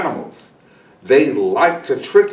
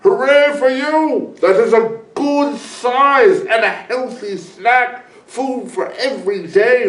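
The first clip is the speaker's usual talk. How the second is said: high, loud hooray; cheering praise; 7 semitones higher, 3 dB louder than usual. A narrator's reading. Eagle praises the boy's snack, cheering. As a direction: cheer the child on.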